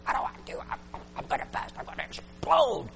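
breathless unvoiced whisper of panic; panicked whisper. Preacher retelling his panic at a camp meeting, breathless whisper. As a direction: whisper like you're about to burst. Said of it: "I said".

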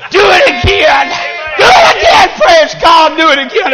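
high revival cry, peaking very high; rousing, high-pitched call for praise; exultant preaching shout. Acted. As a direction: cry God's command out high and excited. God's answer repeated as rising shout.

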